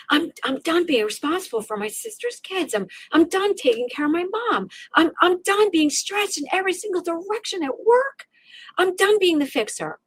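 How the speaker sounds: fed-up cry of a woman at her limit; exasperated outburst. Retold or imitated. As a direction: pour out the exhaustion, each line climbing. Venting exhaustion, listing burdens.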